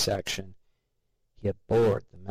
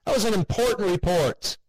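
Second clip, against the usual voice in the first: higher, louder furious outburst; angry shout; 10 semitones higher, 3 dB louder than usual. A narrator's reading. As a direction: yell it at the empty doorway. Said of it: "he shouted".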